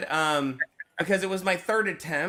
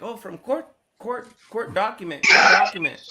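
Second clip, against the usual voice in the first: high, halting foreigner talk; insistent explaining; 6 semitones higher, 7 dB louder than usual. Retold or imitated. Showing court paperwork to non-English speaker, insistent explaining. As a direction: spell it out in keywords, voice raised.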